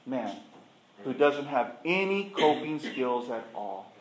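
slow, measured, heavy pauses between phrases; deliberate blunt verdict. Acted. Therapist giving her honest opinion of him, slow and blunt. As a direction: spell it out slowly, letting each judgment land.

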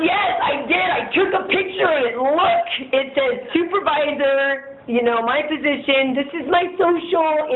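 high excited protest; prove it to the doubting husband. Retold or imitated. Defending herself to husband.

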